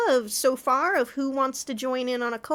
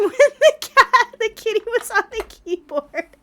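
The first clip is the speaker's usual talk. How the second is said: high laughing through the words; 9 semitones higher, 7 dB louder than usual. Real, not acted. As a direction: giggle while telling it. Laughing about cat on keyboard.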